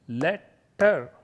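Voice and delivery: "letter" broken into let, ter; split into syllables. Shown as a demonstration. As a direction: say "letter" as two separate beats. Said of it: "they are two syllables".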